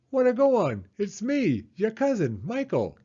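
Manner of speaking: high, loud happy cousin; excited greeting. Acted. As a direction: burst in with glee.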